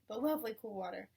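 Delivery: usual speaking voice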